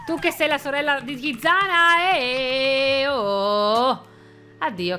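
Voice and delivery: ordinary talk, nothing marked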